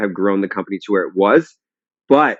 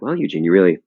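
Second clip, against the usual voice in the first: imitating a coach's lower-voiced reprimand; lightly voiced stern advisor, pitched down; admonishing executive coach; 5 semitones lower, faster than usual. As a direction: play the coach telling off the boss. Acted quote of coaches admonishing a CEO.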